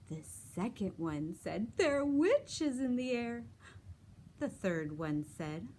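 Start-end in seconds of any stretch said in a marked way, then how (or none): 1.8-3.5 high, loud spooky warning; excited pumpkin voice. Acted. Pumpkin voice in finger play.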